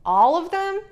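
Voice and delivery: high, loud amazed question; a young fox, wide-eyed at the news; surprised. A narrator's reading. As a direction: squeak it out, impressed.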